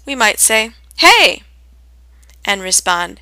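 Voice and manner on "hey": excited hey. Shown as a demonstration. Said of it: "meeting with a friend".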